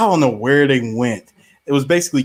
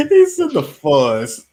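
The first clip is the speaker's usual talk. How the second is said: slow words squeezed through laughter; laughing; 11 semitones higher, slower than usual. Real, not acted. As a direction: repeat the punchline while cracking up. Still laughing at the joke.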